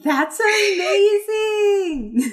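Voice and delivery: high, drawn-out delighted shout; excited yell. Acted. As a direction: yell it out with amazement, stretching the word. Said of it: "she yells out to the point where Connie appears".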